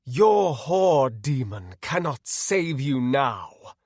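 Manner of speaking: slow, grand, loud pronouncement; stately villain menace; gloating villain paladin. Acted. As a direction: gloat slowly over your prisoner.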